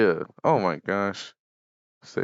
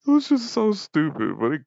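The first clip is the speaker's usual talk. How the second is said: high squeal of laughter, real; cracking up at a silly memory; laughter bursting through the words; laughing; 9 semitones higher, 4 dB louder than usual. Real, not acted. Laughing recalling silly show.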